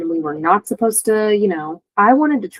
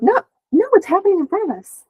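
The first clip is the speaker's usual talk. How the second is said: much higher, fast and urgent; insistent high protest; 12 semitones higher, faster than usual. Retold or imitated. Pleading with the women that it's happening right there, fast and high. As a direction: push back hard, no no.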